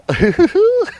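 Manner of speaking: squeaky high giggle; high frog laugh. Acted.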